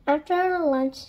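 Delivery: ordinary delivery, nothing marked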